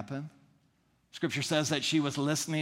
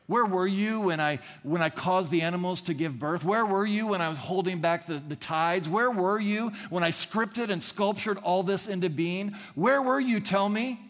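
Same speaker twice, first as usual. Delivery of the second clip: builds from raised voice to a booming high shout; God's rising challenge; 5 semitones higher, 3 dB louder than usual. Acted. God confronting Job, building to a booming shout. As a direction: press the question harder each time until it thunders.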